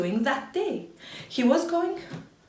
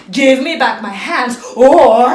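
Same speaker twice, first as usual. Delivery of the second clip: much louder threatening shout; angry threat; 15 dB louder than usual. Acted. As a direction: threaten with the stick raised. Man threatens with stick.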